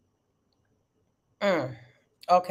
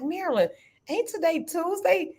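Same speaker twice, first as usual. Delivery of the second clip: confused self; 9 semitones higher than usual. Retold or imitated. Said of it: "I was like".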